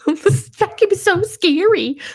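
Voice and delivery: high, loud, slow whimpering pet voice; scared dog voice. Pretend. Friend voicing Penny the dog, scared of the vacuum, high and slow. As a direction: play a terrified little dog.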